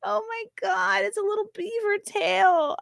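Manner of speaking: very high squeal, nearly crying with delight; very high squeal, almost crying; squealing, near tears. Real, not acted. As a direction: gush like it is too cute to bear. Overwhelmed by cute tail.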